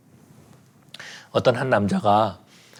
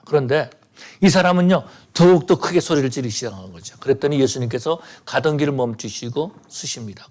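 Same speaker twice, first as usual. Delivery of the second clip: normal talking voice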